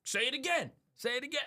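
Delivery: high, mock menacing dare; threatening driver. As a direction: dare him to repeat it.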